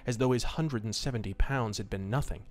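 steady, low storytelling voice; story narration. A narrator's reading. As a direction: read it like an old pulp radio tale. Reading a pulp story aloud.

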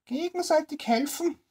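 put-on high, scoffing old woman cry; indignant old woman. Old woman mocking the tramp's plea, high scoffing cry. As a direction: throw his words back at him, pitched high. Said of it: "rief die Frau".

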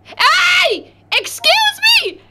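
high shouted call; shouting. Real, not acted. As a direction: yell to get attention. Calling out to players.

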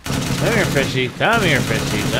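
high, coaxing sing-song call; talking to fish. Pretend. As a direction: coax the little fish closer.

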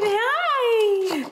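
excited pet voice. Real, not acted. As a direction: coo at the excited dog. Greeting dog.

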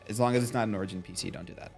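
ordinary delivery

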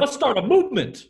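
rally them to your side; mock rallying cry of total agreement; aggressively agreeing. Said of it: "it's aggressively agreeing with them".

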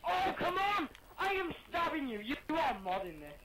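loud annoyed yell; frustrated outburst. Real, not acted. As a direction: shout at the unfair game. Frustrated in knife fight.

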